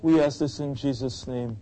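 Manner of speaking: high, insistent praying voice; fervent prayer. Real, not acted. Pastor praying aloud for the congregation, fervent. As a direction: plead to God in repeated, rising appeals.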